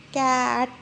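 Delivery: flat robot voice. Shown as a demonstration. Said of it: "how the robot will say".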